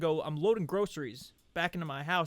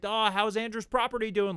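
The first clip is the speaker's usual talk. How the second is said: raised, louder needling question; mock taunt; 6 semitones higher, 5 dB louder than usual. Schoolmate passing in the lunchroom, loud mocking taunt. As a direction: needle someone in passing, pitched up.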